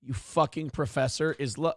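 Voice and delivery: ordinary talk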